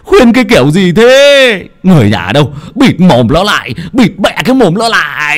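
loud, high outraged yelling; furious panicked bellow at the family; alarmed angry shout. Acted. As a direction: shout in horror, stretching the ends of phrases. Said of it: "tái mặt mà quát".

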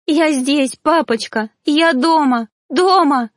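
high, loud crying shout; desperate shouting. A narrator's reading. Ghost girl to her unhearing father, crying shout. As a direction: shout so he will hear her. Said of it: "кричала она".

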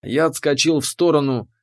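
ordinary delivery, nothing marked

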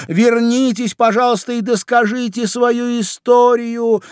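high, loud pleading call; calling after. A narrator's reading. Alice calls after the departing mouse, pleading loudly. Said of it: "крикнула ей вслед Алиса".

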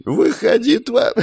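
very high scream collapsing into coughing; shrieked challenge that cracks into a hacking fit; voice breaking. A narrator's reading. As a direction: scream until the voice gives out. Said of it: "Клер окончательно сорвала голос и закашлялась".

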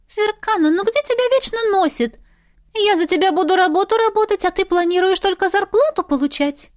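high, sugary scolding; sweet-voiced scolding fairy. A narrator's reading. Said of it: "Очень нежненьким голоском наехала".

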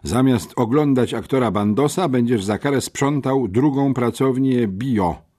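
ordinary delivery

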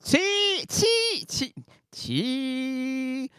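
put-on old woman calling from bed, stretched out; a croaky old granny calling out; granny calling from her bed, lightly voiced; grandmother calling. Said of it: "lui cria".

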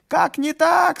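high, loud peasant yell; defiant shout. A narrator's reading. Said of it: "сказал тот же голос".

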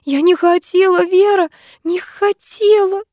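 high, slow, shaking wail; trembling horror. A narrator's reading. Lyusya pulls Vera up, wailing in horror. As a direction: stretch it out in horrified sobbing. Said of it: "Она так сильно дрожала".

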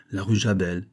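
usual speaking voice

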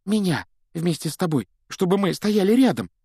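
put-on high, excited little boy voice; eager schoolboy fan. A narrator's reading. Boy asks his idol for a signed photo, eager. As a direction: plead for a photo in a high, boyish voice.

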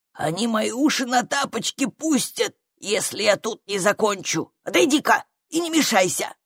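fretful little workman creature voice; harried grumpy creature. A narrator's reading. Creature pushes girl aside to work, harried grumbling. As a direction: grumble like a creature behind schedule.

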